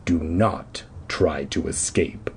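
whispered deep threat; low whisper. A narrator's reading. Caped man whispers his threat, low. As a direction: breathe the warning low. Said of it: "in a low growl".

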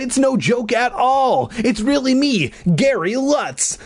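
high, drawn-out pleading cry. A narrator's reading. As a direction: insist slowly and high, desperate to be believed. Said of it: "I cried".

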